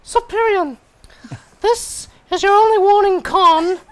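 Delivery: high, loud, hammed-up sultry voice; mock seduction shouted way up high; sexy voice. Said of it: "I will do my sexy voice".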